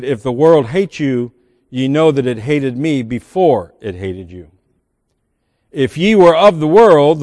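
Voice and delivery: solemn Bible quote; scripture reading. Acted. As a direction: read the words of Jesus gravely. Quotes Jesus in John.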